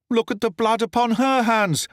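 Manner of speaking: high urgent accusation; desperate cry. A narrator's reading. The accused man turns the blame on the lady, high desperate cry. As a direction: shout it back at her. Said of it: "I cried".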